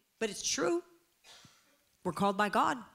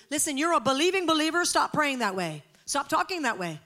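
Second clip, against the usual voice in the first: fast, louder push; urgent; 5 semitones higher, 5 dB louder than usual. Real, not acted. Preacher urging believers to stop that prayer, fast and loud. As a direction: rush it at them.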